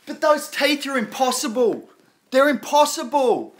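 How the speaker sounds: overplayed envy; mock jealous rant. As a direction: whine about a rival's perfect teeth.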